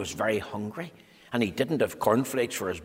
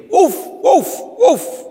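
high, loud barking woofs; loud barking dog; 11 dB louder, slower than usual. Acted. Narrator voicing the loud dogs of Loudland, barking. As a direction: bark it out like a noisy dog.

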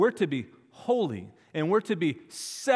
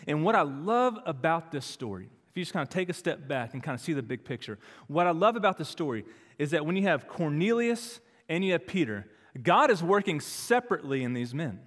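normal talking voice

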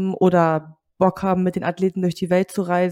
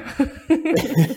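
light laugh; 6 semitones higher than usual. Real, not acted. Interviewer laughs along.

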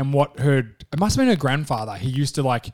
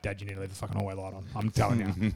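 fast, much softer pleading; scared kid pleading; 7 semitones lower, 11 dB quieter, faster than usual. Retold or imitated. Frightened teen begging dad for hallway light, quiet plea. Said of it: "I'm like".

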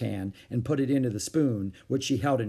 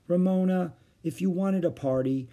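higher, mildly pleading question; exasperated mother; 6 semitones higher than usual. A narrator's reading. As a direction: ask the child in weary bafflement. Weary mother questions Ramona.

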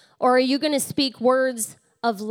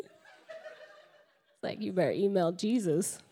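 laughing punchline; 4 semitones lower, 9 dB quieter, slower than usual. Real, not acted.

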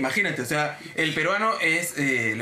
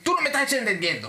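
higher, impatient scolding; arrogant; 6 semitones higher than usual. Retold or imitated. Cliente que regaña al agente, prepotente. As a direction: talk down to the agent. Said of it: "la voz, este, prepotente".

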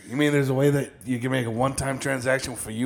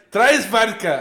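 higher-pitched mock German order; German accent; 6 semitones higher, slower than usual. Co-host quotes the film's German drink order, mock German accent. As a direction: imitate a German ordering at a bar. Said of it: "like a real German fuck".

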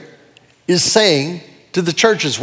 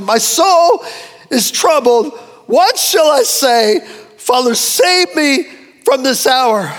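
higher, slow, pleading voice of a troubled man; anguished plea; 5 semitones higher, slower than usual. Acted. Preacher voicing Jesus in anguish, slow pleading cry. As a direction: cry it out slowly as if torn inside. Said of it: "look at the process Jesus is going through".

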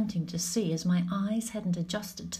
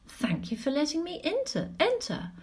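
polite; 6 semitones higher than usual. A narrator's reading. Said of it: "the more polite approach".

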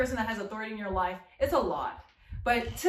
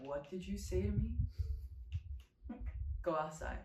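stern teacher; 3 semitones lower, 11 dB quieter than usual. Pretend. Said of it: "I'll be like".

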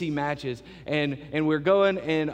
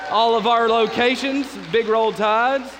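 1 calling out; 7 semitones higher, 7 dB louder, slower than usual. Real, not acted. Pastor hypes crowd, Bama cheer.

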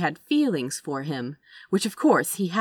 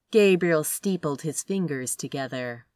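plain reading voice

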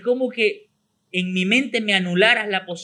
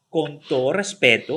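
slow, lowered, ceremonious delivery; pompous, hand on chest; 5 semitones lower, slower than usual. Shown as a demonstration. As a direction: say it solemnly, like a weighty preamble. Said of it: "Manito en el pecho y pico parado". (solemne, con la mano en el pecho)

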